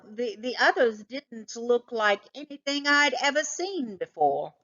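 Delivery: ordinary delivery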